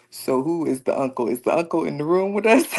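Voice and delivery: loud, rising question breaking into laughter; amused bewilderment. Real, not acted. Mother asking who this unseen uncle is, amused bewilderment. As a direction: ask it loudly, on the edge of laughing.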